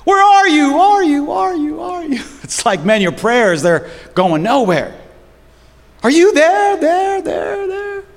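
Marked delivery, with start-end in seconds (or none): 0.0-2.2 high plea fading to near silence; mock prayer fading out; desperate plea. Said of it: "you're desperate". 6.0-8.0 high call with a fading echo; mock echo in an empty room. Mock echoing prayer.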